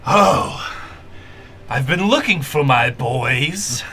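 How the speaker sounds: booming draconic voice; lightly voiced booming dragon; half dragon voice. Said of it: "you hear this booming draconic voice go".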